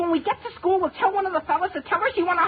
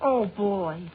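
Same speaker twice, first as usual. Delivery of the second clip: low, drawn-in murmur of delight; blissful low sigh of words; low dreamy swoon; 10 semitones lower than usual. Acted. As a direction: savour it, pitched well down. Dreamy over girlfriend's letter.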